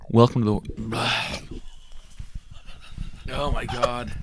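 breathless, sighing mid-intro; out of breath. Real, not acted. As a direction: run out of air after chugging. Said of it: "if I sound really out of breath".

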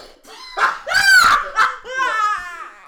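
high shrieking burst of laughter; loud shrieking laugh. Real, not acted. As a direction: crack up loudly, almost screaming.

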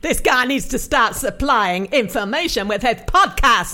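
loud, slow mock American drawl; hammed-up American accent; broad American accent. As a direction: play a puzzled loud American. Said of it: "broad, probably offensive, uh, American accent".

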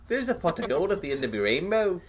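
high-pitched Simpsons leprechaun brogue; mock Irish, lilting up high; cartoon Irish accent. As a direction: do a hammed-up stage-Irish leprechaun. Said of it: "that's a Simpsons".

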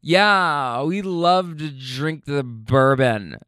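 slow, drawn-out tipsy imitation; drunk woman. Drunk girlfriend chiming in from the back, tipsy drawl. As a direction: stretch the words like a tipsy passenger. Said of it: "with a drunk person".

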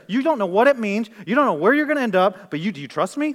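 full-force preaching in Jesus's role; forceful preaching challenge. Acted. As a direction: press the disciple with urgent demands.

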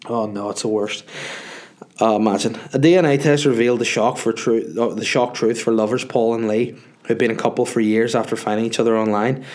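usual speaking voice

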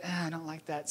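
soft, grumbling aside; reluctant little groan, played for laughs; mock reluctance. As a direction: brush it off quietly, half-joking. Voicing own reluctance to God.

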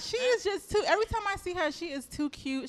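high, loud gushing; shower her with praise; gushing compliments. Real, not acted. Host praising guest's look, high excited gushing.

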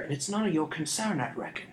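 calm, cold scoundrel's voice; eerily even thief. A narrator's reading. Thief brushing off the boy's accusation, eerily calm. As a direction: keep it level and creepy. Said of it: "tone of voice eerily even".

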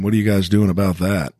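normal talking voice

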